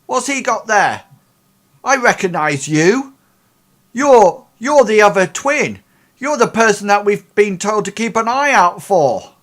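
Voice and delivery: high, loud challenging guard voice; suspicious guard. Acted. A security guard confronts the twin by the bed, high and loud. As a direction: demand it like a guard who caught an intruder.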